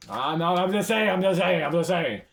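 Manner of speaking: high, loud, repeated self-defence; loud protest. Real, not acted. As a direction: protest your innocence loudly, easing at the end. Playful protest after admiring a skin.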